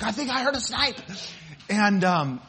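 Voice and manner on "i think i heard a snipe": higher, playful fake excitement; mock alarm. Pastor pranks the kids on a snipe hunt with mock excitement. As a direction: tease the kids that a snipe is near.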